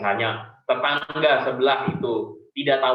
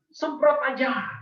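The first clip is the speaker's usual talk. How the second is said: mock hot-tempered urging; 14 semitones higher, 3 dB louder, slower than usual. Voicing people who say let it rip.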